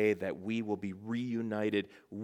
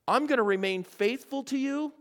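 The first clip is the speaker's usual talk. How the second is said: lightly voiced Paul, higher and earnest; a hint of Paul vowing loyalty, raised high; 12 semitones higher than usual. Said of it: "Paul would say".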